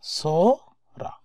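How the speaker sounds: সরা drawn out very slowly in a breathy whisper; slow whispered word. Shown as a demonstration. Teacher slowly whispering সরা for students. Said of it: "আর নিচে যেটা সরা".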